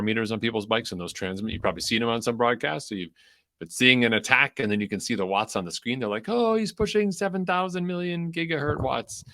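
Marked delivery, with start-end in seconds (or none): 6.3-9.2 high-pitched hyped commentator voice; hammed-up race commentary; excited commentator. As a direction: gush in a high, mock-amazed commentator voice. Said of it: "if you don't watch the commentary".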